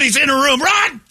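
shrill, loud mock panic. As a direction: scream at them to flee. Panicked victim shouting warning.

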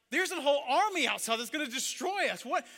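loud, high-pitched outburst; frantic servant yelling at his master; panicked protest. Acted. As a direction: shout in acted panic and disbelief. Panicked servant protests about the army.

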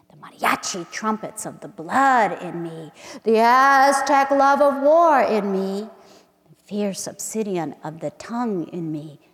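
slow, intimate poem recital with hushed drops; dramatic spoken-word reading; poetry reading. Acted. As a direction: read it like a love incantation. Reads her poem aloud.

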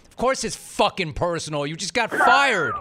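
hammed-up outrage for the fired guest; mock outrage. Real, not acted. Host ribbing a fired wrestler, mock-indignant yell.

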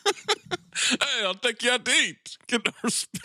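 high, laughing take on Dangerfield; a giggling mock Dangerfield; Rodney Dangerfield imitation. Host mimics his friend's Dangerfield bit through laughter. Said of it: "Rodney Dangerfield".

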